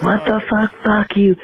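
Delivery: mock furious girlfriend. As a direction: squeal it like the enraged ex. Voicing ex's furious reply.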